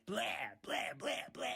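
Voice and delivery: noisy, rough blah sounds; mock retching blahs. Ranter imitates music he dislikes, messy blurted noise. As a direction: spew out a row of ugly blahs.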